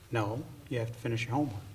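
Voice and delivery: a lightly voiced parent; dismissive parent. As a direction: rattle off the parent's no. Parent answering child.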